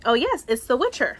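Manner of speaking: ordinary talk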